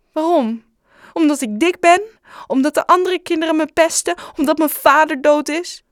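high, loud hurt shouting. Acted. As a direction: hurl the questions back in pain. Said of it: "Bob schreeuwde nu ook".

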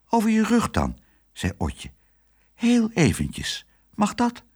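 put-on high, coaxing girl voice; sweet little girl. A narrator's reading. Otje coaxing Herman, high and sweet. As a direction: charm the dog gently.

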